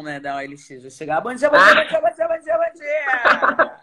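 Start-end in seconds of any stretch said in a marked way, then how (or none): 1.2-3.8 cheery high tour-guide greeting; tour guide aunt. Retold or imitated. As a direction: chant it like a chirpy excursion leader. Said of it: "Isa é tia da excursão".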